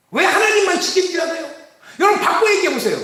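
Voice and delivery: ordinary talk, nothing marked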